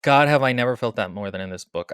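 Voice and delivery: ordinary talk, nothing marked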